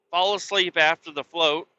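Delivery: ordinary talk, nothing marked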